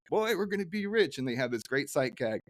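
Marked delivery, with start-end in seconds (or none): 0.1-1.2 put-on excited old man. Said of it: "they're like".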